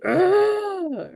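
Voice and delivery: high, exasperated "ugh"; acted groan of annoyance; irritable groan. Acting out irritability.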